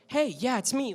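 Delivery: high, youthful put-on voice; young character voice. Shown as a demonstration. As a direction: pitch it up into a younger self. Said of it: "like, this voice".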